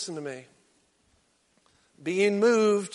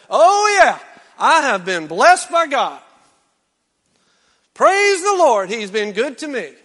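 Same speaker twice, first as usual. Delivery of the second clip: higher, louder hammed-up church voice; mock praise; 5 semitones higher, 10 dB louder than usual. Preacher mocks people who only proclaim blessing, hammed-up praise. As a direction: send up a showy believer.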